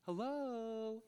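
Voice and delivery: high, soft mocking call into silence; mock hello. Preacher mocking a silent idol, soft high hello. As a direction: call out to an idol that won't answer. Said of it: "I asked your idol a question".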